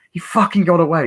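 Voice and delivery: high, loud exasperated report; retold irate outburst; acted angry frustration. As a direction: spit out the bad news in mock anger.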